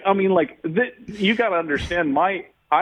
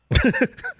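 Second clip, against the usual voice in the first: high burst of laughter. Real, not acted. Laugh mid-story.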